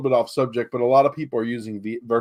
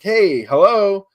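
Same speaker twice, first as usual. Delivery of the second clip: friendly hello; 7 semitones higher, 7 dB louder, much slower than usual. Retold or imitated. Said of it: "if someone's like".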